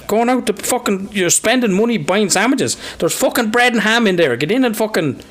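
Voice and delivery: a hint of an old-school father giving out; lightly voiced scolding parent.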